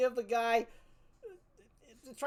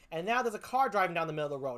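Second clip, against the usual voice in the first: ordinary delivery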